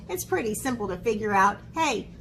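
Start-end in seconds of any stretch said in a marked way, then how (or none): none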